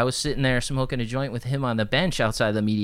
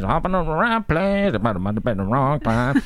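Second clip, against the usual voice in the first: lightly voiced musician, higher start, a bit louder; 3 semitones higher, 3 dB louder than usual. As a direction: toss off his witty line in a put-on voice. Retells [name]'s words.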